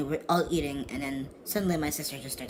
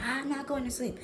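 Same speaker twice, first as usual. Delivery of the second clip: imitated screaming; 10 semitones higher than usual. Sister refusing bed, imitated high scream. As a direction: imitate a wailing sister in a much higher voice. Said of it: "before that she was screaming".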